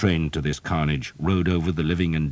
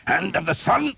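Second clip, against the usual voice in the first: high snarled blessing. Acted. Father Hobb breaking a man's nose, high snarl. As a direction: spit it out as the staff lands. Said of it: "Father Hobb snarled".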